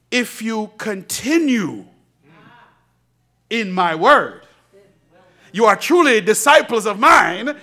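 building preached verse. Acted. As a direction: preach it to the rafters.

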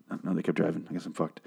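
low, very soft and deflated; hushed, acted defeat; quiet mock resignation. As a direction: let the hope drop out, almost under your breath. Acts out rescue driving away.

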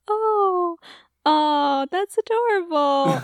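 high, put-on cooing; fake enthusiasm. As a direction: feign delight at a coworker's photos. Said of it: "it's very fake".

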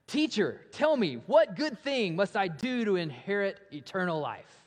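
an eager seeker rushing up to the teacher; eager young man. Retold or imitated. Young man pleading with Jesus, excitedly eager. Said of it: "And he's really excited".

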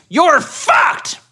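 high shouted threat; mock scream. Reading the attacker's scream aloud. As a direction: yell it like the attacker. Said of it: "One of them screams".

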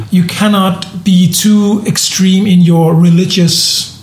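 ordinary talk

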